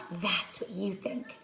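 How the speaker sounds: soft, slow smug aside; a soft, slow inner voice; private thought. Acted. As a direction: mutter it to yourself with quiet glee. Stanley's silent thought.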